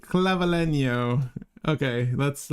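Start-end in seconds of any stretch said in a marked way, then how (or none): 0.0-1.3 slow, drawn-out Spanish-style Clavilenio; Spanish accent. Shown as a demonstration. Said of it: "we gotta get the accent in there".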